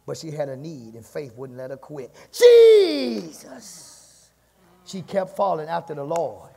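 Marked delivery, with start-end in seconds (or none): 2.3-4.3 loud, high, drawn-out cry for help; long pleading holler that falls away at the end; desperate shout. Acted. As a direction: shout after someone far ahead, holding the vowel.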